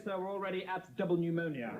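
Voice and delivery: usual speaking voice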